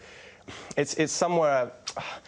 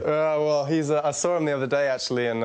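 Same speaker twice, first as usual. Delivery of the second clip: usual speaking voice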